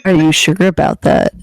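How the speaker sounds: mock stern challenge. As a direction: press them like a game show host. Repeated louder as mock challenge.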